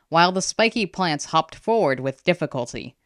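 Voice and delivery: ordinary delivery, nothing marked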